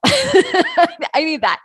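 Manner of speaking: high squeaky laugh through the words, drawn out slowly; laughing plea. Real, not acted. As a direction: laugh it out high and long, half begging.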